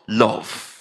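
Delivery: ordinary talk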